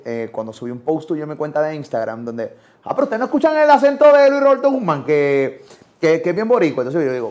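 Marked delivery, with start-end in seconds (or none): 2.8-6.8 high, loud put-on voice of an online critic; mocking commenter voice. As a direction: parrot the commenter's smug protest, pitched way up. Quoting an Instagram commenter.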